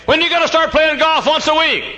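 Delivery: high-pitched, loud mimicry; mock nagging. People pushing preacher to play golf, mock nagging shout.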